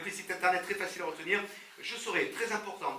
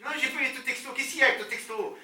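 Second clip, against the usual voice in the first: irritated louder reply; 8 semitones higher, 7 dB louder than usual. Retold or imitated.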